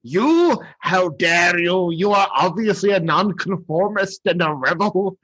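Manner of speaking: over-the-top outraged tyrant; Burgermeister. Acted. Burgermeister accusing the toy-giver of rebellion, hammy indignant voice. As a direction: leap high on the first word, then pompously accuse. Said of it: "how over the top the Burgermeister is".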